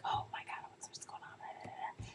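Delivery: whispered gossipy aside; barely audible, drawn-out whisper; hushed onlooker. Retold or imitated. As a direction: whisper it like a curious bystander. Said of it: "you're like".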